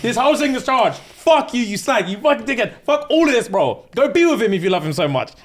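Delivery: high, loud raging insults; furious rant. Retold or imitated. Boyfriend raging at girlfriend after hearing she cheated, furious shouting. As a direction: lose it completely at her.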